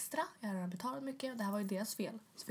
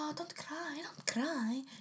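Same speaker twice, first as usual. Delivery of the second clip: retold worried fluster, pitched higher; 7 semitones higher, much slower than usual. Repair man to crying customer, imitated worry. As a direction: imitate an anxious plea in a higher voice. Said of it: "han blir så orolig".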